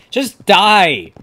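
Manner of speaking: high, loud frustrated shout; exasperated yell. Real, not acted. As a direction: yell at an opponent who won't go down.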